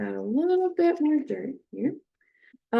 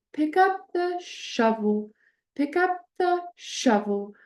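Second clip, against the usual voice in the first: sing-song teacher voice, higher and slower; acoustic highlighting; 6 semitones higher than usual. Shown as a demonstration. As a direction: Pick up the shovel with higher pitch and stress at the end, slow. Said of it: "adding that stress and emphasis at the end".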